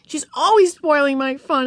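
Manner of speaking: put-on high, complaining girl's tirade; demanding. A narrator's reading. Beezus complaining to Mother about Ramona, high and aggrieved. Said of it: "Beezus demanded".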